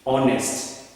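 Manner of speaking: normal talking voice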